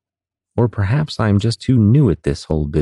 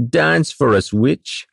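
put-on high taunting drunk voice; mocking sailor; 8 semitones higher, much slower than usual. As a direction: sneer it like a drunk.

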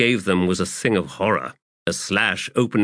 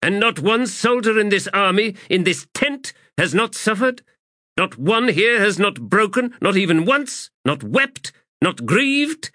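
harsh and much higher, pressing each question; 13 semitones higher than usual. Acted. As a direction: turn suddenly hard and accusing. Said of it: "Suddenly her voice was harsh".

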